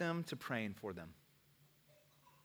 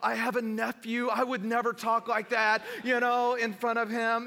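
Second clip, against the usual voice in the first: much higher, louder, flustered apology; higher, half-shouted mock apology from the young man; apologetic young guy; 7 semitones higher, 4 dB louder than usual. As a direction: play the embarrassed guy blurting out sorry. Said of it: "one dude's like".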